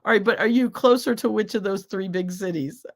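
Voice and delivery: ordinary talk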